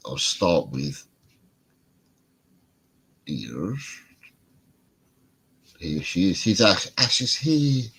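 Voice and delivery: ordinary talk, nothing marked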